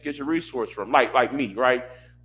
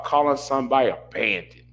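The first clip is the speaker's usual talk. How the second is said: usual speaking voice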